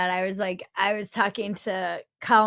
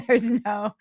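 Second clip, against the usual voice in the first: normal talking voice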